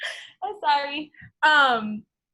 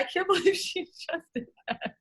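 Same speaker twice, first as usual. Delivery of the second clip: laughing disbelief; 9 semitones higher than usual. Retold or imitated. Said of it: "I was like".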